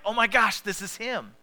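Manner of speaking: high, loud gasp of realization on "Oh my gosh"; Mary recognizing Jesus, bursting out in surprise; sudden recognition. Retold or imitated. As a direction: she suddenly knows it's him, overjoyed. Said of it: "she was like".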